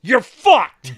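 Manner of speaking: loud, high imitated shout; trooper losing it. Trooper hears there is no dispensary container, shouting. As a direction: deliver the verdict as a yelling trooper. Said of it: "Fucking loses it".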